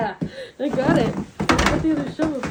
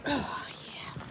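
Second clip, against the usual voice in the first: whispering; 10 dB quieter than usual. Real, not acted. Whispered aside.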